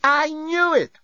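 high wailing self-reproach; self-blaming wail. Acted. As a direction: moan it at herself. Lucy panicking, guilt.

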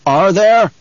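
barked interruption. A narrator's reading. Paul cutting off Gurney's objection about guildsmen, barking. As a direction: cut in loud and high. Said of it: "Paul barked".

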